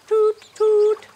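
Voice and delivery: high, loud toot toot; train toot. Pretend. Leader tooting a train horn for kids, high. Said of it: "Pull the horn".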